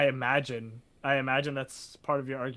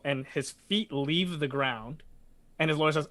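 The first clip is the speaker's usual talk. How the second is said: ordinary talk, nothing marked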